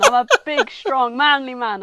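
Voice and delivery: overacted manly bluster; mock macho boast. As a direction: puff yourself up, hammed-up. Voicing America boasting of his manhood.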